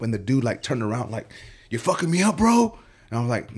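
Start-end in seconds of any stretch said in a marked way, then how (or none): 1.7-2.8 higher-pitched imitation of an upset client; alarmed accusation. Client turns on barber mid-haircut, alarmed accusation. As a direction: pitch climbing into indignant disbelief.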